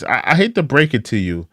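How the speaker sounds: ordinary talk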